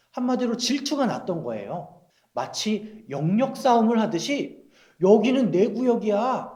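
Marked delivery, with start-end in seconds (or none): 5.0-6.5 mock turf-war grumbling, pitched high; jealous complaining. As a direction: act out an indignant, higher-voiced complaint. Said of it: "이렇게 질투하면서 불평을 했다". (질투하며 높은 목소리로 불평하는 연기)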